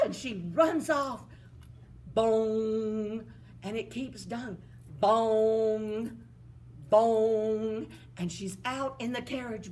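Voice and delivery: loud mock clock chime; clock bong. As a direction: ring out each bong. Clock striking twelve.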